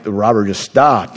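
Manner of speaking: ordinary talk, nothing marked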